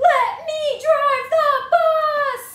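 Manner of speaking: very high, loud slow screech; frantic pigeon yell. Acted. Pigeon going crazy for the bus, loud high screech. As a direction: lose it, demanding the bus. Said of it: "he's going crazy for this bus".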